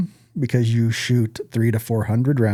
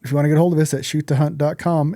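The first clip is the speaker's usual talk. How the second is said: normal talking voice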